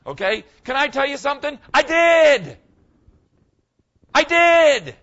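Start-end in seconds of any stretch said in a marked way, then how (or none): 1.7-4.9 excited shout. Real, not acted. Joyful testimony to congregation.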